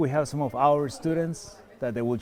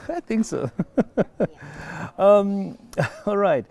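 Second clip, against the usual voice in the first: laughing; 6 semitones higher, 5 dB louder than usual. Real, not acted. Host laughing with guests.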